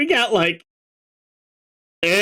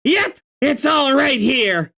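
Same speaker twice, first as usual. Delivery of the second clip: Karkat ranting; 10 semitones higher than usual. Acted. Voicing Karkat.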